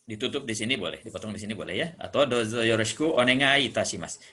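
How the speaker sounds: ordinary talk, nothing marked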